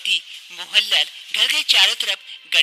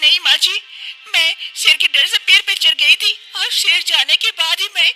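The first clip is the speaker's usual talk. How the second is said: put-on high-pitched young wife, breathless and relieved; young wife explaining; 10 semitones higher, 4 dB louder than usual. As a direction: tell her mother-in-law how you escaped. (ऊँची आवाज़ में बहू, राहत भरी)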